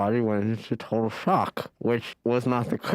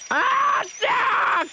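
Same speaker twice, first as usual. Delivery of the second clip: screaming in pain; much slower than usual. Acted. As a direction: yell out in agony. Painful dental procedure.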